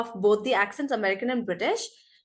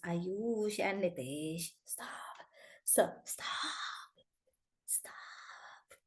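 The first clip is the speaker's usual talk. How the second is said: drawn-out whisper; hushed telling-off; 4 semitones lower, 13 dB quieter, much slower than usual. Real, not acted. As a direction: whisper it slowly to two pupils. Hushed telling-off of two students.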